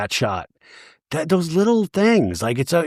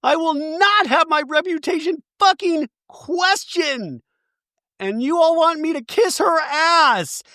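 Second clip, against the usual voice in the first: much higher, hammed-up outrage; mock indignant; 13 semitones higher than usual. Angie defending her reputation, high mock anger. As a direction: screech like a wronged friend. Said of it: "Angie goes".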